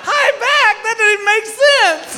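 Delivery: high, slow, dopey voice; goofy sedated slur; drugged giddiness. Retold or imitated. Drugged patient confused.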